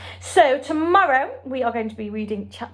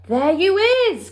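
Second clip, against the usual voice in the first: much higher, louder eager giant; excited cry; 11 semitones higher, 5 dB louder than usual. A narrator's reading. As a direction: blurt it out in triumph. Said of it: "cried the B F G".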